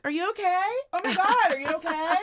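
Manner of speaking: high, falsely worried fuss; fake concern. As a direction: overplay the innocent helper. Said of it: "He was like".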